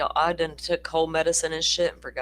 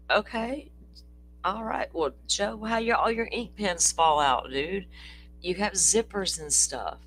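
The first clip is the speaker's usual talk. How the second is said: mock incredulous; 6 semitones higher, 4 dB louder than usual. Real, not acted. Teasing Joe about his pens.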